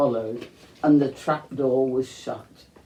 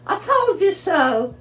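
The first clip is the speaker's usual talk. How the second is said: smug; 5 dB louder than usual. A narrator's reading. Smug quote in narration.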